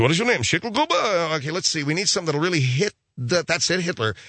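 high-pitched showbiz agent, brainstorming; talent agent. Acted. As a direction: play a talent agent hitting on a stage name. Acts talent agent renaming Hitler.

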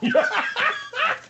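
very high cackling burst; laughing. Pretend. Host laughs to keep from crying, high cackle. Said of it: "What are you laughing at?".

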